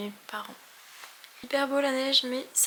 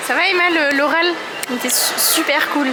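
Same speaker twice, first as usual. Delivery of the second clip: calling out; 5 semitones higher, 9 dB louder than usual. Real, not acted. Calling out to friend excitedly.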